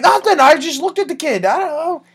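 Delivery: high, loud mock-panicked denial; flustered protest. Accused man protesting to relatives about the kid, flustered protest. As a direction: squeal your innocence in exaggerated alarm.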